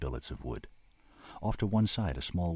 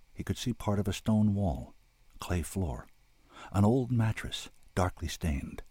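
ordinary delivery

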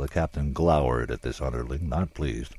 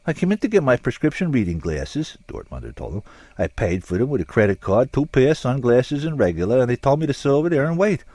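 higher, aggrieved crook explaining himself; Dortmunder's voice; 10 semitones higher, 6 dB louder than usual. A narrator's reading. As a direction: plead innocence like a put-upon customer.

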